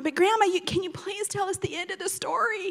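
high-pitched kid's plea, imitated; begging grandchild. Grandkids beg Grandma for the story's end, mock begging. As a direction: wheedle Grandma like an eager child.

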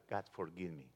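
a hushed petition to God; quiet prayer. Acted. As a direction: drop the voice right down to ask forgiveness. Voiced prayer.

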